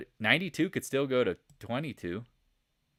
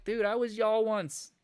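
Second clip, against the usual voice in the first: emotional; 8 semitones higher than usual. Retold or imitated. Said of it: "I get emotional thinking about it".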